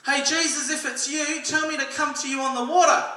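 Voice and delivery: loud, high-pitched call across distance; yelling out. Acted. Peter calling to Jesus on the lake, yelling out. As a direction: holler it over the water. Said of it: "and Peter yells out".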